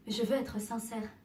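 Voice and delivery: ordinary delivery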